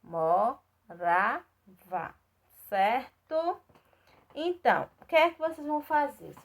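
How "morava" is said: "Morava" said very slowly, syllable by syllable: mo-ra-va; syllables spelled out. Shown as a demonstration. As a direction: stretch "Morava" into three slow, separate syllables. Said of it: "três sílabas moravas".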